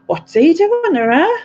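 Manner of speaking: high, loud imitation of a puzzled mum; imitating mum. Mum on the phone, puzzled high voice. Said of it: "she'll start going".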